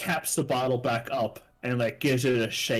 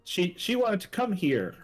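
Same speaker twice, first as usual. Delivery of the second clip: higher, halting excuse from a caught man; flustered character explaining; 5 semitones higher than usual. Acted. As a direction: stumble through a hurried explanation. Game master voices caught man explaining.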